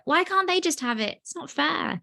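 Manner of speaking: put-on high pleading child voice; childish plea. Child watching hungry kids on TV, high innocent plea. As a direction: ask it like a puzzled little kid.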